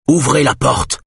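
loud command at a locked door. A narrator's reading. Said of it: "dit-il d'une voix forte". (d'une voix forte, ordre puissant)

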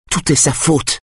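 high-pitched accusation, not shouted; accusing, pitched well up. A narrator's reading. Lydia blames him in the confrontation.

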